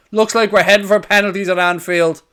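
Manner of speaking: ordinary delivery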